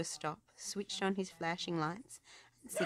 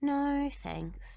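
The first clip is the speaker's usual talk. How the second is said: pup voice; 10 semitones higher, 4 dB louder than usual. A narrator's reading. Rubble declining.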